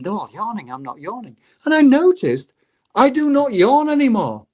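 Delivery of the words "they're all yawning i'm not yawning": softer, muttered to himself; quiet inner thought. Retold or imitated. Teacher in a meeting, quietly thinking to himself. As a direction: think it smugly under his breath.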